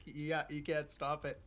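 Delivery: ordinary talk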